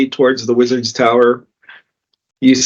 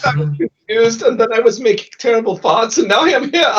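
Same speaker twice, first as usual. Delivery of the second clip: confused character voice; 9 semitones higher than usual. Acted. Player voicing a confused refugee.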